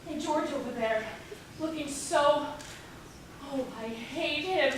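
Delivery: ordinary talk, nothing marked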